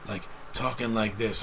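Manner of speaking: low, subdued robot-like imitation; mock an actor who talks like a robot; robotic actor. Reviewer mocking actor's American accent, low and robotic. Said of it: "they talk like robots".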